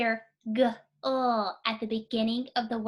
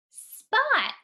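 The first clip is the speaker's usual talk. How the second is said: "Spot" said whole, higher and louder; blended answer; 9 semitones higher, 5 dB louder than usual. Shown as a demonstration. Teacher to pupils, bright high reveal of the blended word. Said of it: "What's the word?".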